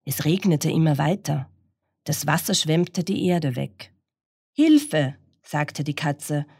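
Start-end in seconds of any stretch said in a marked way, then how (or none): 4.5-5.2 high, louder cry for help. Acted. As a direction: call out in alarm. Cat on toppling tree cries help.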